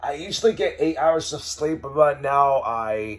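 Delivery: loud, high cheerful narration; host it with energy; upbeat vlog host. Acted. Upbeat morning vlog narration over music.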